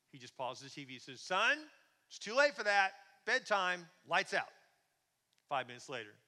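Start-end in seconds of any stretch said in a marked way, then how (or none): 1.2-4.5 loud, stern dad voice; calling back firmly. Acted. Father calling up to his thirsty son, loud and firm. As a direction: shut down a child's request from downstairs.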